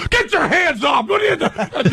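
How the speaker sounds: high, sudden yelled order; mock yell. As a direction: bark it like an angry airport guard. Security guy yelling at crew.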